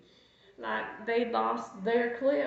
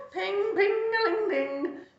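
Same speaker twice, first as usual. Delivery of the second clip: high, slow singsong pings; glockenspiel imitation; 9 semitones higher than usual. Dog practises on the climb, high sung chimes. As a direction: sing out the chimes slowly, pitched up.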